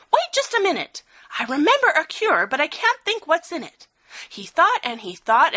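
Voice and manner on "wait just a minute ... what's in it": high, sudden eureka voice; light up as the idea arrives; excited elephant. A narrator's reading. Elephant remembering a cure to his friends, higher and excited.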